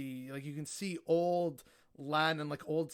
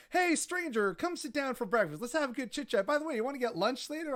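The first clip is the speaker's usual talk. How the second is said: high, bright hamming of a chummy stranger; mock cheery invite; 9 semitones higher, 4 dB louder than usual. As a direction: overdo the breezy friendliness. Mocking the casual invitation.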